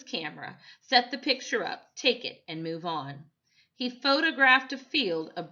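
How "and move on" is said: plain reading voice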